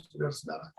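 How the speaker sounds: ordinary talk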